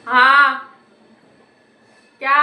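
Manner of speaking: shouted हाँ to someone far off; loud call back. Real, not acted. Girl shouting back to her mother upstairs, loud. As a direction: call out हाँ loudly so mom hears.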